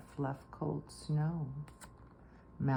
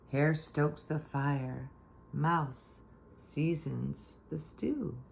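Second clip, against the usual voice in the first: usual speaking voice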